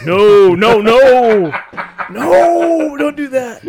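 loud, high laughing no no no; laughing shout. Real, not acted. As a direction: shout him down through laughter. Laughing protest at friend's joke.

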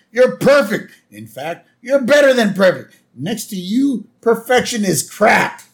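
high, loud rave; thrilled producer raving at an actor; gushing praise. Retold or imitated. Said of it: "Roger Myers Junior is like".

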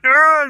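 loud high "argh" as he gets hit; pained grunt. Retold or imitated. Eiling struck by Barry, a loud high yelp of pain. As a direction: cry out as you're smashed down. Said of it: "and Island's like".

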